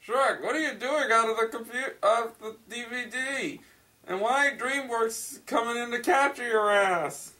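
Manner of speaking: high-pitched, incredulous scolding; hammed-up scandalised questioning; mock alarm. Hammed shock at Shrek appearing.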